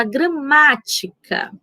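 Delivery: "gramática" with a long, stressed "má". Shown as a demonstration. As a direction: linger on the "má" of "gramática". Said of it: "qual foi a sílaba que eu demorei mais".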